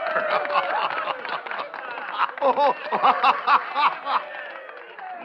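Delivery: big hearty gloating laugh. Acted. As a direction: auctioneer laughing at his profit. Auctioneer gloating.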